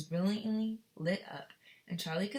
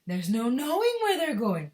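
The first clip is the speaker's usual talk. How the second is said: louder, higher crazy cry; gleeful cry; 6 semitones higher, 9 dB louder than usual. Acted. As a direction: crow it out, almost hooting. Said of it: "cried Mister Wonka, hooting with laughter".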